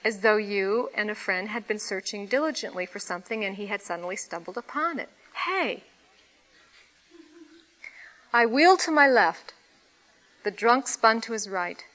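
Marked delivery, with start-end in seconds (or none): 5.5-5.8 joyous lilting call. A narrator's reading. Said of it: "strangely joyous, lilting quality of it".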